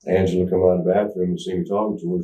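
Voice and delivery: usual speaking voice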